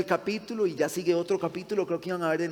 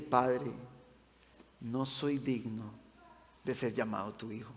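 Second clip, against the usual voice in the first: tearful; 5 semitones lower, 8 dB quieter than usual. Real, not acted. Pastor reading the son's plea as he breaks into tears, soft and tearful. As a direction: barely get the words out, tearful. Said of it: "yo me suelto en llanto".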